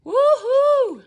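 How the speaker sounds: ordinary talk, nothing marked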